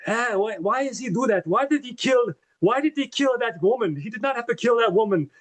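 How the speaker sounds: high-pitched, hammed-up protest in a put-on voice; mock-upset pleading, pitched well up; distraught Dimitri.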